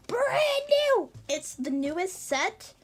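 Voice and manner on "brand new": thrilled squeal, very high; excited shout. Real, not acted. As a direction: hype it up loud. Repeat of the hype.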